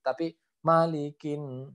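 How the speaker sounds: malikin with the final nun held long; slow malikin, hold the nun. Shown as a demonstration. Tajwid teacher holding the doubled nun. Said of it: "nun yang bertasdid harus ditahan".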